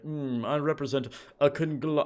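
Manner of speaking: put-on slow, posh-sounding British accent with a knowing "Mm". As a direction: play a smug British villain, slowly. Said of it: "I don't know why he has a British accent".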